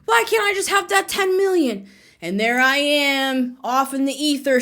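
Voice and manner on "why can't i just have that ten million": high-pitched pleading whine; mock begging. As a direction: beg in an exaggerated, higher voice. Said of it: "begging the universe".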